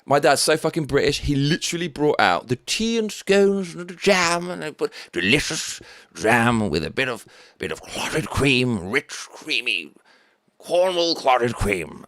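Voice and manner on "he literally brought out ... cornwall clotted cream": slow, indulgent relish; savouring it. Real, not acted. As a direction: linger over every creamy word. Savouring clotted cream.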